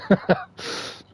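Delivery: quiet unvoiced chuckle; soft breathy laugh. Real, not acted. Player laughs softly.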